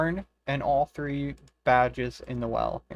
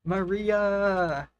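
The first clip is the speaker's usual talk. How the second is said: higher, louder shout of a name; excited call; 7 semitones higher, 4 dB louder than usual. Real, not acted. Streamer cheers for Maria's rare drop, excited shout.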